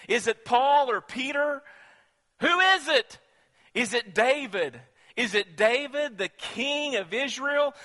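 high, loud pulpit delivery; preaching at full force. Acted. Preacher proclaiming the lion of Judah, full force. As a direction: preach it with fire all the way.

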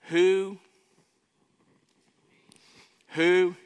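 who, who called out loud and high; emphatic call. Shown as a demonstration. Preacher stressing Romans to the church, loud. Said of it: "that's emphatic".

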